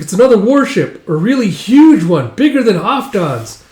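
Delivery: high, loud breaking news; excited child. Retold or imitated. Child reporting a warship to father, excited. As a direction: report it wide-eyed and urgent.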